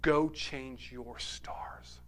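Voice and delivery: slow, breathy whisper of a father; hushed whisper. Retold or imitated. Father kneeling, whispering to his son slowly. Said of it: "grabs hold of his son and says".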